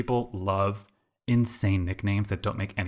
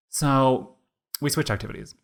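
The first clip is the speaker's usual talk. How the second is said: usual speaking voice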